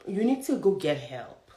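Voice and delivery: ordinary talk, nothing marked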